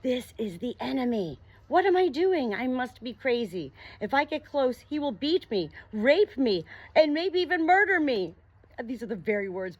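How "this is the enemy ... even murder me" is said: high and loud, rising panic; terrified of the monster getting close; panicked fear. Acted. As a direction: spiral into frightened alarm. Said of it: "Your heart is racing wildly".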